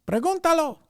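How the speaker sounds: high, loud Spanish order; mom's urgent command. Retold or imitated. Mom urging her son to ask, high and loud. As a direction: shake the kid and demand it. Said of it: "shake me and say".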